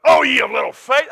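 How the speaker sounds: high, loud scolding; mock harsh rebuke. Jesus wrongly imagined scolding Peter, mock loud rebuke. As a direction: snap it like a stern master. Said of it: "he didn't jerk him up".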